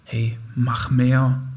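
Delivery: slow drawn-out nudging inner voice; slow coaxing "Hey, mach mehr"; inner voice pushing. Acted. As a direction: stretch out "mehr" like a tempting push. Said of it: "Vielleicht so die Stimme".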